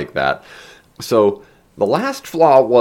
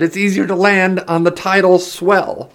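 high, projected over wind; raised voice; 11 semitones higher, 4 dB louder than usual. A narrator's reading. Ben explains the landing to Heron, calling over wind. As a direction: call out the explanation.

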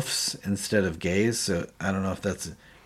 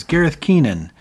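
Gareth barks his own name, higher and louder; proud self-introduction; 7 semitones higher, 6 dB louder than usual. Retold or imitated. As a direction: announce your full name like a proud soldier.

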